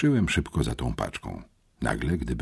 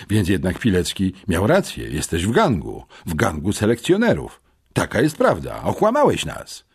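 raised, emphatic accusing voice; indignant accusation; 5 semitones higher, 5 dB louder than usual. A narrator's reading. Boy confronts schoolmate about joining the gang, accusing. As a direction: confront him, louder with each charge.

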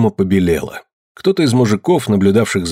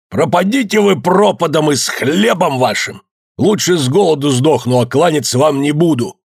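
higher, defiant outburst; angry shout; 5 semitones higher than usual. A narrator's reading. Захар storms off from his in-laws, angry shout. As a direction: curse them and refuse to bow. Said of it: "крикнул Захар".

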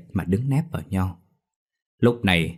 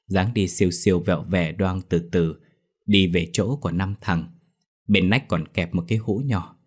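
ordinary delivery, nothing marked

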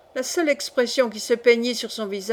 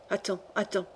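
very soft, slightly lowered threat; murmured; 3 semitones lower, 9 dB quieter than usual. A narrator's reading. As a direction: murmur it under cover. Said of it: "murmura le patient". (murmure très bas)

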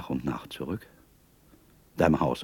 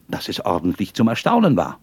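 plain reading voice

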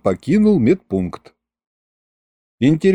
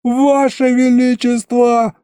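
high, slow, relieved cry; relieved wail; 10 semitones higher, slower than usual. A narrator's reading. Patient wails with relief to the king, high. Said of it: "облегчённо завопил я".

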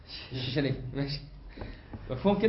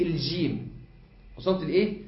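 ordinary talk

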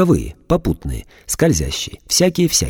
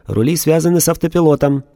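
higher, flat machine voice; a dispassionate robot answering; emotionless robot; 8 semitones higher than usual. A narrator's reading. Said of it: "бесстрастно ответил робот".

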